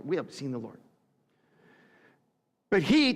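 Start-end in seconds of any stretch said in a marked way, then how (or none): 0.0-0.8 hushed, awed repeat. Shown as a demonstration. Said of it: "I just love that line".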